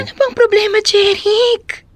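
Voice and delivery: high-pitched exasperated demand. Acted. Woman confronting her boyfriend who pulls away, exasperated. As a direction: confront him, voice pitched right up.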